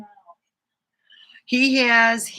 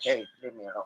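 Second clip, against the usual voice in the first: low, soft grumble of an annoyed cat; cat grumbling at its owner to back off; grumpy cat voice; 7 semitones lower, 8 dB quieter, much faster than usual. Pretend. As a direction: voice the cat, fed up and wanting peace. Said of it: "He's like".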